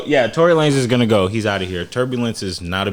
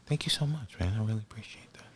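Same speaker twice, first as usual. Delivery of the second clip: much softer imitation of grateful thanks; hushed retold reply; 14 dB quieter than usual. Comedian thanking a fan in the shop, very quietly. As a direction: drop to a very quiet, confidential thank-you.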